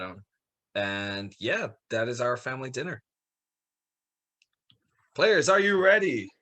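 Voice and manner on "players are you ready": calling out. Acted. Host calls out to players.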